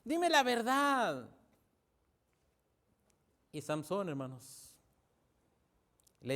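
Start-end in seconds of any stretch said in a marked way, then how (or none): 0.0-1.3 slow, drawn-out acted pleading; gentle pleading. Dalila presses Samsón for the truth, slow coaxing plea. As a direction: stretch each phrase out, imitating a coaxing lover. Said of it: "le empieza a decir suavemente".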